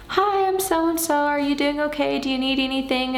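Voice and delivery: high-pitched, syrupy concerned-stranger impression; sweet churchgoer voice. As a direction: mimic an overly friendly parishioner checking on you. Churchgoers greeting her, voiced.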